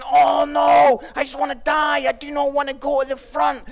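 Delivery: loud, high comic panic; panicked dread. Retold or imitated. Student called to pray in dirty clothes, acted panic. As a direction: wail it as a hammed-up inner protest.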